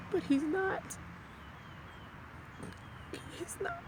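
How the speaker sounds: tearful high crack; breaking voice. Real, not acted. Grieving widow insists, voice cracking.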